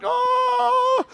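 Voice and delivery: long, very high wailing "oh"; hammed-up agonized moan; mock suffering moan. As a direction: wail it out, held high. Acting out fasting misery.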